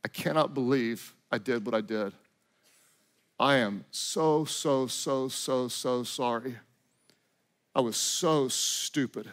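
hushed, low, slow remorse; drawn-out, quiet apology weighed down by sorrow; godly sorrow. Acted. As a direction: confess it softly and heavily, full of regret. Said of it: "A godly sorrow".

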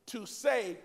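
ordinary talk, nothing marked

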